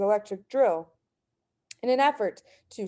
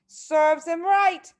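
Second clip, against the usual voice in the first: slow, higher, louder smug mother; mother's voice; 8 semitones higher, 5 dB louder, slower than usual. A narrator's reading. Mother judging the nose-picking boy, slow and smug. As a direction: drag it out with satisfaction.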